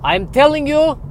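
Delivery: put-on high, loud final warning, Dracula-style accent; furious Eastern European vampire accent. Vampire giving his questioner a last warning, furious Eastern European accent. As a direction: boil over in a stagy vampire accent. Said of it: "question about the accent".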